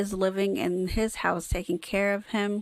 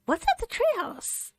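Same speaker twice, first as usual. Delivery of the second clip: voice cracking. Real, not acted. Kid voice cracking as he asks about the treehouse. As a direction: voice cracks up high into another octave. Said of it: "my voice, like, cracked".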